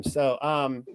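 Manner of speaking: usual speaking voice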